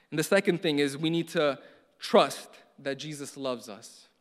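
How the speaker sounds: ordinary delivery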